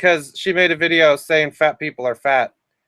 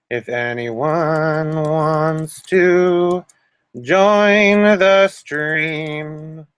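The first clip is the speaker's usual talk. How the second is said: very slow stretched invite; drawn out; much slower than usual. Acted. Streamer inviting viewers, slow drawn-out invitation. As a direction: stretch every word out. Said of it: "stretched invite".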